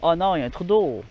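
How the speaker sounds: ordinary delivery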